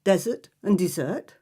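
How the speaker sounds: normal talking voice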